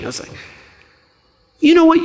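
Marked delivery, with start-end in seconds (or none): 1.6-2.0 high-pitched retold exasperation with the nephew; fed-up retort. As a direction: pitch it way up, as if you've had enough. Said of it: "and I had enough".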